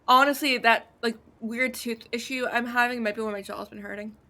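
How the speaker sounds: ordinary delivery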